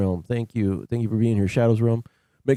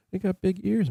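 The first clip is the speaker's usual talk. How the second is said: higher cooing to the dog; 10 semitones higher than usual. Real, not acted. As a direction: coo over her big ears. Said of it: "baby talk Maddie".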